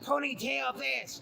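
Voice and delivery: shouted mock babble; mocking yada yada. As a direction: sneer the yadas like a parrot. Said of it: "you're there like".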